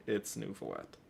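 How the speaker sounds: usual speaking voice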